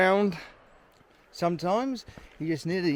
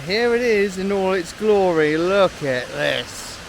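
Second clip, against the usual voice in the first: excited; 4 semitones higher, 7 dB louder than usual. Real, not acted. Reaches the big waterfall.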